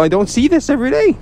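fast, very high squeal; high-pitched insistence. Real, not acted. Player insisting the match is unusual, high squeal. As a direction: shoot the voice way up, hurried. Squeals his repeated claim.

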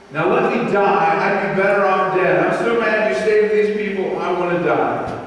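loud, dramatic complaint to God; angry Jonah. Acted. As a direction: act out a fed-up prophet, slowing at the end. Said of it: "Jonah was really upset and angry".

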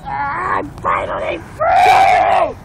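loud, slow, drawn-out mock yell; triumphant shout. Pig character declares freedom.

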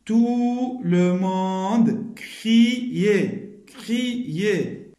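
slow syllabic reading; syllable by syllable. Shown as a demonstration. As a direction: spell out the syllables of Tout le monde criait. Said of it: "Je lis bien les syllabes".

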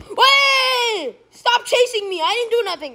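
high, pleading cry to be left alone; panicked protest. Real, not acted. Player begging a pursuer to stop, panicked.

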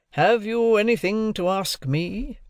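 high, slow questioning; questioning lawyer. A narrator's reading. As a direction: put it slowly, as a cross-examiner. Lawyer cross-examining his ward.

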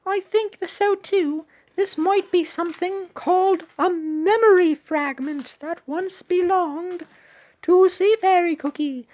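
squeaky eager cartoon sidekick reading game dialogue; high cute shark voice. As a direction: pitch way up for the little shark character. Reads shark character's lines.

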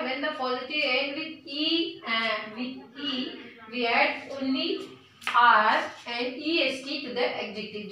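reads the rule very slowly, word by word; slow dictation. Shown as a demonstration. Teacher dictating a grammar rule to students, very slow.

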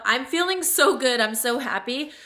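ordinary talk

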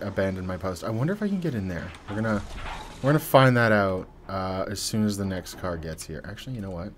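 usual speaking voice

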